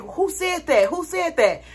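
mock startled. Answering an unknown voice about drinking water, mock startled. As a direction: call out as if hearing an unseen voice.

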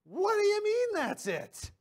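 high-pitched, disbelieving protest; incredulous objection. Acted. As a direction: balk at it like a stunned skeptic.

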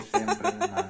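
hammed-up proud. Joking shout-out again.

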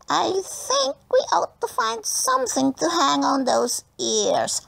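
high, sneering schoolboy voice; nasty bully boy. A narrator's reading. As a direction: taunt her in a high nasty tone. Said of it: "said Simon Sorley".

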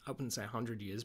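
ordinary talk

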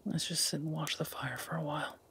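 very soft, near-whispered contentment; hushed, intimate and content, with long pauses; tender murmur. Acted. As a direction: speak softly to someone resting in your arms. Cuddling contentedly by the fire.

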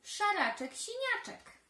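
high, loud playful chant; children shouting with delight; gleeful chant; excited calling. Acted. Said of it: "zawołały dzieci".

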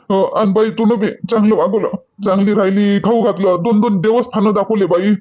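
usual speaking voice

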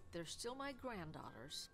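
usual speaking voice

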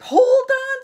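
very high, loud squawk; urgent warning. A narrator's reading. Yellow duck warns the goats, high alarmed squawk. Animals all warn Little Gruff.

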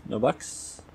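ordinary talk, nothing marked

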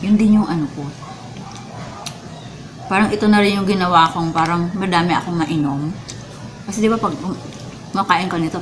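ordinary delivery

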